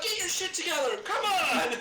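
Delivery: loud, high-pitched comic pleading; exasperated yell. Retold or imitated. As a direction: re-enact yelling at the news. Yelling at the news, re-enacted.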